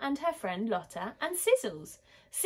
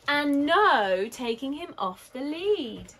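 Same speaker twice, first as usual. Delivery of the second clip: loud, drawn-out NO, higher and slow; emphatic warning; 6 semitones higher, 7 dB louder, slower than usual. A narrator's reading. Marv gives his most important dog rule, stressing "no" emphatically. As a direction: lean long and hard on "no". Boy laying down key rule.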